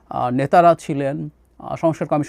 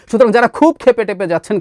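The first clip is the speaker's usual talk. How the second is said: ordinary delivery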